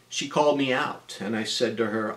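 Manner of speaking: normal talking voice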